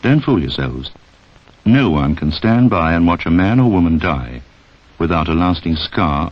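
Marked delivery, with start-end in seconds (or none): none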